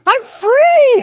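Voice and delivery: squeaky high-pitched cry of joy; helium voice. Real, not acted. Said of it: "Pardon my helium voice".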